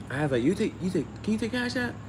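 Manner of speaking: ordinary talk, nothing marked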